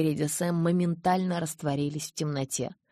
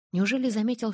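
usual speaking voice

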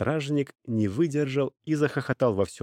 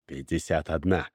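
lightly voiced merchant, low pitch; a lower voice for the bargaining buyer; 6 semitones lower than usual. A narrator's reading. Merchant makes a counter offer.

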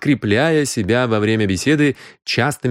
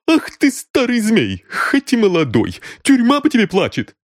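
a red-faced maid who has had enough; indignant maid; 12 semitones higher than usual. Acted. Maid throws the boy at the detective's feet, outraged shout. Deborah shaking her fist.

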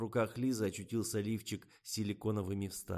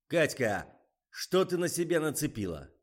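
loud frantic yelling; frenzied outburst; 8 semitones higher, 9 dB louder than usual. A narrator's reading. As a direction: lose it, yell at her. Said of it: "снова впал в неистовство".

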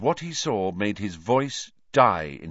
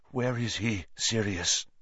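suppressed emotion; 4 dB quieter than usual. A narrator's reading. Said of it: "a voice that shook with some suppressed emotion".

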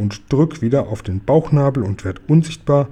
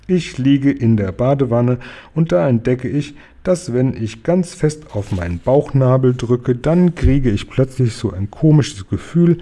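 usual speaking voice

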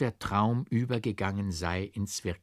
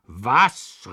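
sharp, loud startled what; a loud indignant burst; outraged cry; 2 semitones higher, 7 dB louder than usual. A narrator's reading. Forester cries out at the monk, outraged. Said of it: "rief der Förster".

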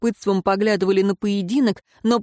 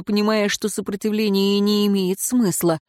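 ordinary delivery

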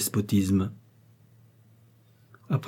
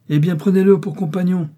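higher and louder, sharp and dismissive; curt retort; 9 semitones higher, 6 dB louder than usual. Acted. Husband dismissing his wife's plea, sharp raised retort. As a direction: fling the order at her.

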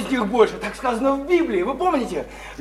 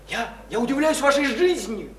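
ordinary talk

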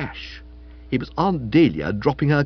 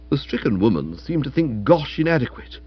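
plain reading voice

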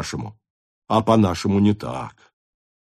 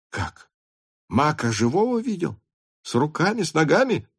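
high-pitched, eager questions; incredulous questioning; 7 semitones higher than usual. Acted. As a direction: press him in amazed high voice. Officers question news in amazement. (изумлённо, высоким голосом)

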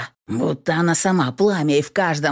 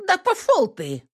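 angry woman. A narrator's reading. Katherine snaps angrily.

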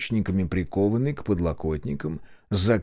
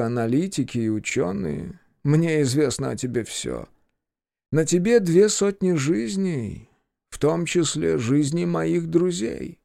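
a hint of a cold menacing boss; 7 semitones higher than usual. A narrator's reading. Villain Vadim's long speech.

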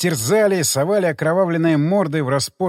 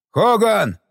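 raised, higher-pitched call; 4 semitones higher, 3 dB louder than usual. A narrator's reading. Dick calls Hogan from the bank, raised call. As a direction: call his name across the water. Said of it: "позвал Дик".

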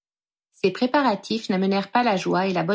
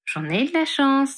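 pleased with himself; 6 semitones higher, slower than usual. A narrator's reading. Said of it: "se félicita Harry".

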